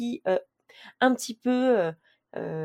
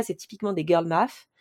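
normal talking voice

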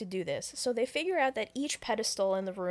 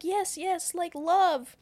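high, eager agreement growing louder; eager urging; 7 semitones higher, 4 dB louder, much slower than usual. Retold or imitated. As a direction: agree urgently, pleading in a raised pitch. Retold Corbin eagerly urging her.

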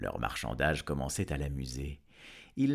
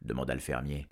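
usual speaking voice

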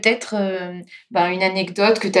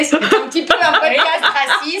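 said through real laughter, higher; cracking up mid-sentence; laughing; 7 semitones higher than usual. Real, not acted. Host laughing at her own embarrassing gibberish, real laughter.

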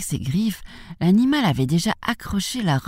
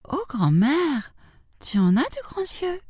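put-on high child voice of wonder; wide-eyed, pitched-up amazement; astonished little girl; 11 semitones higher than usual. A narrator's reading. Girl marvels at disguised wolf.

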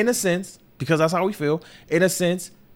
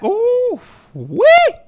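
ooh wee exclamation; 9 dB louder than usual. Real, not acted. Overwhelmed exclamation about pandemic hardship.

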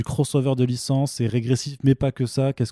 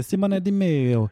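slow, higher-pitched Italian pronunciation; Italian accent; 7 semitones higher, much slower than usual. Shown as a demonstration. Host redoing an artist's name Italian-style for his co-host, Italian accent. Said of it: "je vais la faire à l'italienne".

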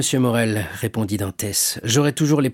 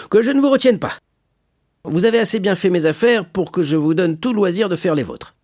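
high, animated jovial voice; hearty shipowner, generous; 6 semitones higher, 3 dB louder than usual. Acted. Shipowner frees Edmond to see to his own affairs, hearty. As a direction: send him off expansively.

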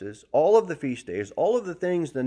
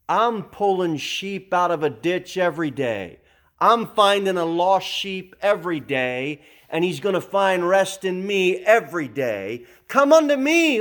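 loud, raised proclamation building to a high call; emphatic Jesus; 5 semitones higher, 5 dB louder than usual. Retold or imitated. Preacher as Jesus calls the heavy laden to rest, emphatic. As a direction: preach it as Jesus, climbing to a ringing invitation. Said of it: "Jesus said".

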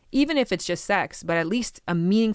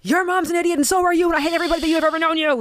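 high, loud, fast mock rant; mock furious rant; 12 semitones higher, 8 dB louder, faster than usual. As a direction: blurt out the hateful thought in a hammed-up burst. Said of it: "not to be like".

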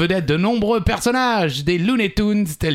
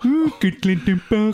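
switching impression voices; 8 semitones higher than usual. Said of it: "qui fait la meilleure imitation?".

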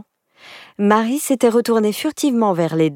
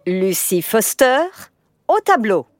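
stern teacher; 10 semitones higher than usual. Acted. Teacher picks pupil.

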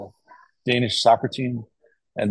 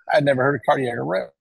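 ordinary talk

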